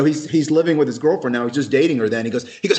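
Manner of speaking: normal talking voice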